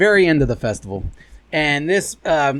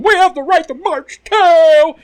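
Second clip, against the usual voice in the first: higher, louder and slow, a jeering protester's cry; mock protester shout; 12 semitones higher, 6 dB louder, slower than usual. Protester yelling his right to march at the crowd, loud and slow. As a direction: belt it out like a smug marcher. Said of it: "they're going".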